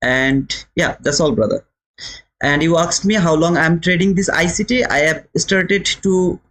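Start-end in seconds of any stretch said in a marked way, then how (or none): none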